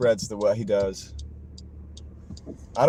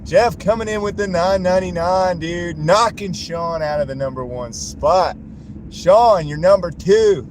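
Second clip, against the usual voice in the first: excited announcing; 5 semitones higher, 6 dB louder than usual. Real, not acted. Streamer hyping donation war.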